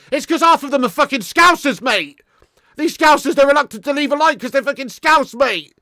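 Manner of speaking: loud, high indignant outburst; indignant rant. Real, not acted. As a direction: shout the complaint at your viewers. Ranting at rival fans watching.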